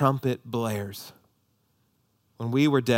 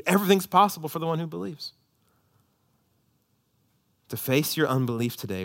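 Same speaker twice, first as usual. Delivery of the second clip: ordinary talk, nothing marked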